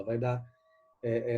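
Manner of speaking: ordinary talk, nothing marked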